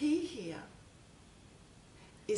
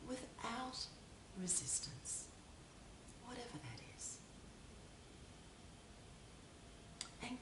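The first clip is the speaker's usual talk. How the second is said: soft spa whisper; 7 dB quieter than usual. Acted. Leads a guided meditation.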